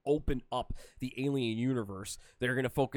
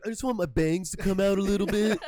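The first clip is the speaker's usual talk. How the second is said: hipster voice; 7 semitones higher, 5 dB louder than usual. Retold or imitated. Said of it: "he's like".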